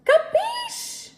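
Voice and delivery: Capiche? in a very high mock falsetto; silly squeaky "Capiche?". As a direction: float "Capiche?" way up like a cartoon. Playful check viewers understood.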